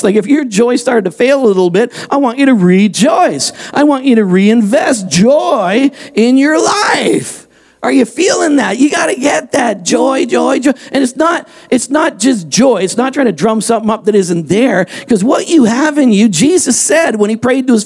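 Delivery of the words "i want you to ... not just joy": high, rising shouted exhortation; fiery preaching. Acted. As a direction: preach it to the back row. Preaching at full force.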